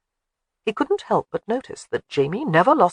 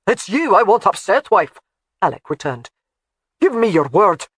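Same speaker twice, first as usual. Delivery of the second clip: louder, stern husband voice; 3 semitones higher, 5 dB louder than usual. A narrator's reading. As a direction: press her, unyielding. Alec pressing his wife.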